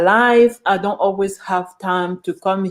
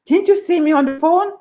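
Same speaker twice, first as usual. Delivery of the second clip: higher, fast, lightly mocked rude retort; rude receptionist; 7 semitones higher, faster than usual. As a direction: brush the customer off. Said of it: "That's how they talk to you".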